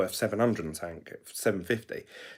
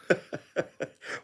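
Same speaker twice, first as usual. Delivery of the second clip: short airy laugh; quick unvoiced chuckle at his own joke; breathy laugh; 8 semitones higher, 4 dB quieter than usual. Real, not acted. Laughing at the Lambo meme, a breathy chuckle.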